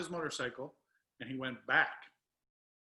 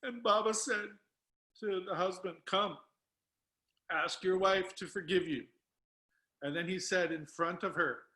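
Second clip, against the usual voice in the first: pushing on through real tears, higher-pitched; tearful; 5 semitones higher, 4 dB louder than usual. Real, not acted. Storyteller quoting Baba's rebuke to the husband, tearful. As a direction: emotion still thick in the voice. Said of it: "Sorry that I'm crying".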